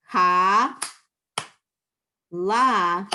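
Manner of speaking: "Ka" et "la" séparés, forts, avec une pause; staccato. Shown as a demonstration. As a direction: syllabes détachées et appuyées. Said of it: "let's do line two like that".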